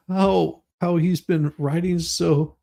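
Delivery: ordinary talk, nothing marked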